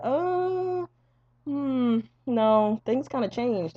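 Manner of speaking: high, drawn-out hesitant hemming; awkward backtracking. Retold or imitated. Doctor returning to parents with worse news, awkwardly hesitant. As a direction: imitate a doctor reluctantly walking it back.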